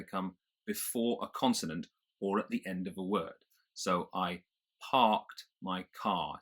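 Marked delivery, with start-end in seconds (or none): 4.1-6.4 slow, non-rhotic "parked" and "car"; British accent. Shown as a demonstration. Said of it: "the R comes before the K".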